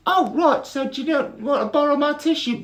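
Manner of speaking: very high, loud squeaky beetle voice; high squeaky Bart. Acted. Dim little brother greeting Barry, high squeaky voice.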